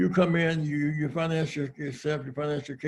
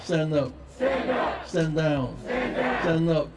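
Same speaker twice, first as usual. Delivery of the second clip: rallying preacher; 4 semitones higher, 4 dB louder than usual. Real, not acted. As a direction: preach it so the crowd repeats. Rally preaching, crowd repeating.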